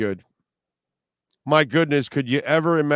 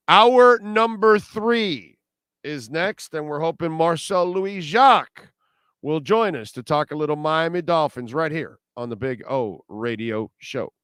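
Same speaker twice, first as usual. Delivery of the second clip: put-on old radio announcer; 5 semitones higher, 4 dB louder than usual. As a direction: boom it out like an old-time announcer. Host promo of next hour.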